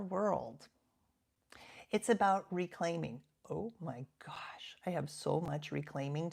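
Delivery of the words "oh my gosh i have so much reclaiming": whispered aside. Real, not acted. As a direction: drop to a whisper, amused. Aside about her own garden.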